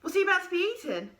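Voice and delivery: ordinary delivery, nothing marked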